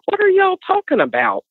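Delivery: wife in the back seat, squeaking in disbelief; exasperated question. Retold or imitated. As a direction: demand to know what they saw. Confused wife pressing for answers.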